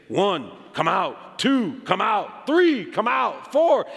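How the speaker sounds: each number rising in force toward a peak; building count. Retold or imitated. Young man commanding the spirits out of the boy, building count.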